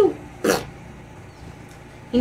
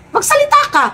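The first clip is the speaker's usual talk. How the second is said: demanding; 12 semitones higher than usual. Acted. Demanding Rick answer.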